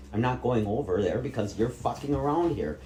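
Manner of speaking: usual speaking voice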